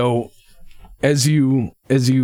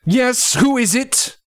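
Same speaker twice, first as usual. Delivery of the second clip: voice behind the door; 11 semitones higher, slower than usual. Acted.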